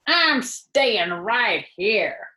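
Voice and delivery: slow, stubborn grumble; the rat refuses, grumbling slowly. A narrator's reading. Rat refusing to go to the fair, slow grumble. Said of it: "grumbled the rat".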